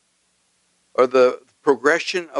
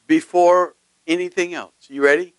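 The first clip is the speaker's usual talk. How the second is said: ordinary delivery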